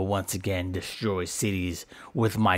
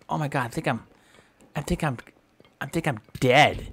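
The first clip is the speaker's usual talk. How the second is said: normal talking voice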